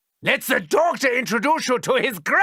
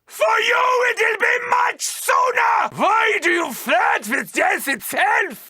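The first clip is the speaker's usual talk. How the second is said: menacing shout; 10 semitones higher than usual. Acted. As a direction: hurl it at the horde. Threatening the horde.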